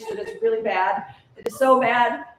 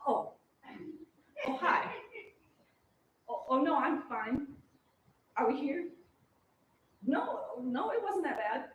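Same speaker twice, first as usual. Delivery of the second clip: dazed and quiet; 7 dB quieter than usual. Acted. Woken, dazed, talking to crew.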